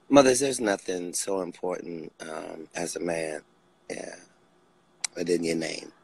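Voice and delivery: ordinary talk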